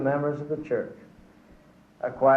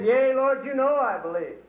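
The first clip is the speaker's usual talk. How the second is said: high, hurried yea Lord; eager quick answer; 9 semitones higher than usual. Acted. Man dangling on the cliff answering the Lord, eager and high. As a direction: blurt it out eagerly to God.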